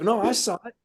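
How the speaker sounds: put-on high, meek young Marine voice; high innocent young Marine; young driver. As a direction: answer like a sheepish kid. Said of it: "He's like".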